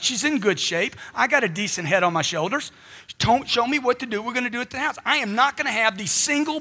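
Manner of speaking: ordinary delivery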